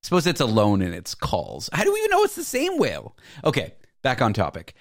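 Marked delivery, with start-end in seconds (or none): 1.7-3.2 very high, quick incredulous outburst. Real, not acted. Host questioning the whale researchers, high-pitched disbelief. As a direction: shoot up high in comic disbelief.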